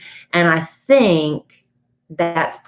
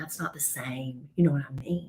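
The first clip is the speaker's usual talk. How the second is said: quiet; 4 semitones lower, 13 dB quieter than usual. Real, not acted. Unimpressed reply to the accent.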